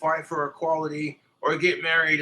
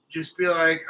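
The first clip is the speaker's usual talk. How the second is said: ordinary delivery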